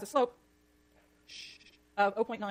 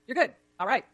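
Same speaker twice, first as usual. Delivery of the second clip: usual speaking voice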